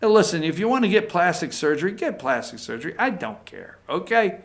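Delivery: a mock-friendly hushed tease; jokingly hushed aside. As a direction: drop to a quick soft jab, half joking. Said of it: "And I say jokingly".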